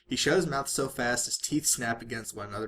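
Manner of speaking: plain reading voice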